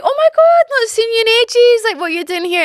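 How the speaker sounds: high, loud mock squeal of surprise; over-the-top delighted shriek, easing off; excited greeting. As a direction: squeal it at a long-lost friend. Acting out surprised greeting to friend.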